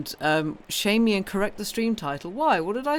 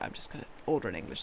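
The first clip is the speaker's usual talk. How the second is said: quick low mutter to herself; hurried private thought, dropped low; inner thought; 10 semitones lower, 9 dB quieter, much faster than usual. Retold or imitated. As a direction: rush it under the breath, much lower and softer. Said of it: "so I was like".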